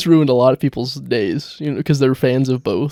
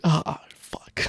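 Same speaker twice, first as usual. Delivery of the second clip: whispered "Ah, fuck"; whispered curse; 2 semitones higher, 5 dB quieter than usual. Pretend. Imagining the reaction to the message, whispered curse. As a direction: breathe it out under your breath. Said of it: "you should be like".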